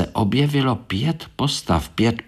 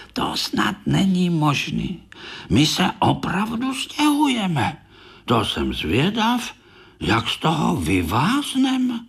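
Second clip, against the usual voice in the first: higher, bewildered beetle voice; dismayed little beetle; 9 semitones higher than usual. Acted. Korálek alone in the attic, bewildered and higher. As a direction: wonder high how on earth you'll get out of this.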